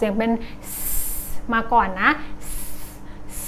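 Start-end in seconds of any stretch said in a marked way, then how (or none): 0.7-0.7 "S" as a long soft hiss; S sound alone. Shown as a demonstration. Teacher isolating the first sound of stone, hissed S. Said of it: "มันต้องออกเสียงเป็น". 3.4-3.4 "S" as a long soft hiss; S sound alone. Shown as a demonstration. Teacher isolating the first sound of stone, hissed S. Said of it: "มันต้องออกเสียงเป็น".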